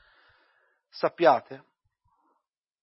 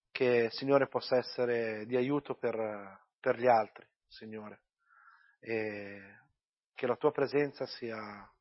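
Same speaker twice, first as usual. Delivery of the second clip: earnest prayer; 4 semitones lower than usual. Real, not acted. Pastor prays before the sermon, hushed and devout. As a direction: pray humbly, pausing often on Signore.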